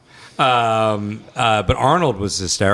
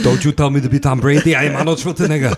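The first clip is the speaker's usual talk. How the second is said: put-on louder Austrian-accented bodybuilder bravado; Arnold Schwarzenegger impression; 2 semitones higher, 5 dB louder than usual. Host asks for Arnold, so he booms back in Austrian accent. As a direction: play it like Arnold, laughing through the Austrian accent. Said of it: "can I interview you as, uh, Arnold".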